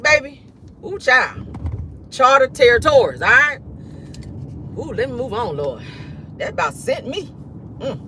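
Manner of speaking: usual speaking voice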